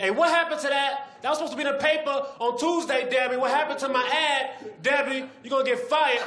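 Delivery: screaming boss at Debbie; boss yelling. Acted. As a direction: bark at the staff. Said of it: "He's yelling at people".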